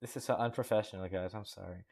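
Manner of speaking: usual speaking voice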